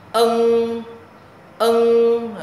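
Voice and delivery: "Ân" humming through the nose, loud, high and long; nasal throat sound. Shown as a demonstration. Teacher models the nose sound, long nasal "Ân". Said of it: "Âm sống mũi ha".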